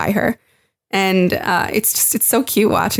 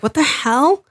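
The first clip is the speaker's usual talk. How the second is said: playing Steve thrown completely off guard; baffled disbelief; 7 semitones higher, slower than usual. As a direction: voice climbing higher into stunned confusion. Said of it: "you see the judgment in his face where he's like".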